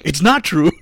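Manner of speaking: hammed-up character line; quoted devil. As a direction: bark it out as the movie devil. Quoting the new devil.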